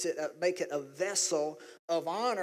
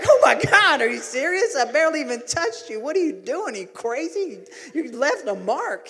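high, shouted comic disbelief; loud mock outrage; 8 semitones higher, 7 dB louder than usual. Husband reacting to wife's hard hit, loud mock outrage. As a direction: protest as if badly walloped.

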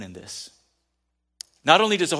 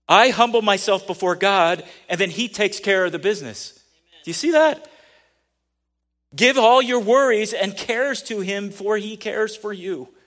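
loud, higher scripture proclamation; preaching at full force; 6 semitones higher, 8 dB louder than usual. Acted. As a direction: proclaim the verse like a warning cry.

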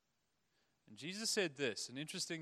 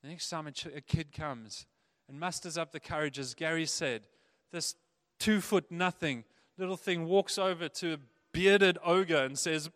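normal talking voice

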